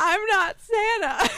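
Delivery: high, slow giggly protest; laughing so hard it breaks the words; hiccup giggles. Real, not acted. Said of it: "I can't stop laughing now".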